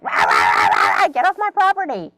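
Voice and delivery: yelling dogs. Retold or imitated. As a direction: bark wildly, then holler like an angry guard dog. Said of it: "they're, like, yelling at us".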